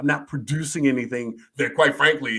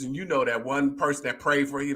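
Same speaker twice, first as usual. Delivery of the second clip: ordinary delivery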